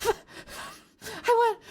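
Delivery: flustered laugh. Retold or imitated. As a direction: squeak it out in panic, then laugh. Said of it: "And I'd be like".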